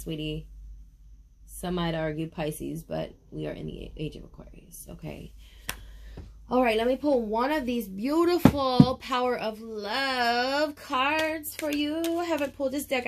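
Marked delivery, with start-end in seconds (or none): 2.8-5.3 secretive unvoiced whisper. Real, not acted. Tarot reader whispering a secret to viewers. 6.5-13.1 higher, slow sing-song. Real, not acted. Tarot reader sweetly announcing the card pull.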